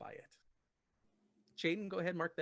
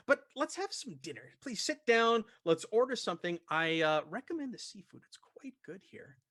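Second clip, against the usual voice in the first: higher-pitched, eager host; Larkin; 6 semitones higher, 7 dB louder than usual. Acted. Expedition leader invites the party to sit for dinner, higher hosting voice. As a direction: invite them to dinner in a lifted, genial character voice. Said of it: "Larkin says".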